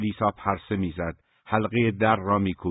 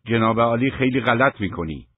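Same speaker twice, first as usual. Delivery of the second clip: raised, higher and louder narrated shout; angry shout; 4 semitones higher, 6 dB louder than usual. A narrator's reading. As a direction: shout the rebuke at a brother. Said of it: "آیدین فریاد زد". (فریاد خشمگین)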